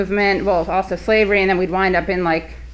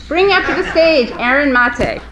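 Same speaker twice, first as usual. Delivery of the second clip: inside a rubber mask; 6 semitones higher than usual. Real, not acted. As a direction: announce through a mask. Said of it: "I'm hot in this mask".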